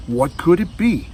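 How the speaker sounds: plain reading voice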